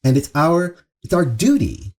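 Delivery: normal talking voice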